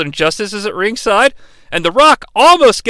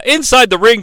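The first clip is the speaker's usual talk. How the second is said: normal talking voice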